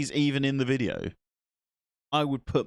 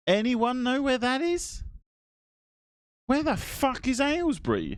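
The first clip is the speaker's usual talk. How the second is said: exaggerated disbelief; 13 semitones higher than usual. Real, not acted. Asks chat in mock disbelief.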